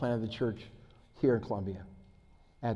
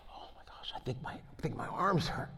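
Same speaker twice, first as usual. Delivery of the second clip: whisper; 9 dB quieter than usual. Retold or imitated. David whispers mid-meeting about arm.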